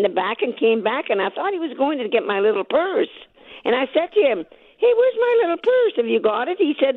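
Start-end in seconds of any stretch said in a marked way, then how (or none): 4.8-6.6 mild retold call, much higher pitch; retold eager question. Customer asking the clerk for her little purse, eager and high. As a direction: pipe up high to ask for it.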